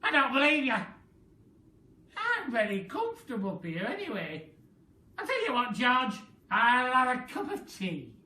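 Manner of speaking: put-on a demanding old lady barking orders; grumpy Grandma. Grandma voiced in story.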